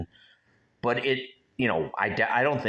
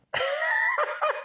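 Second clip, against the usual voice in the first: a sudden high burst of laughter; real laugh. Real, not acted. Laughing at his own talks with the ghost, high real laugh. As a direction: break into a high squeal of a laugh.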